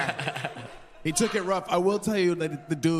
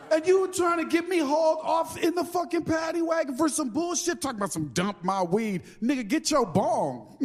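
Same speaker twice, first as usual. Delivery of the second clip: retold outraged rant; 10 semitones higher than usual.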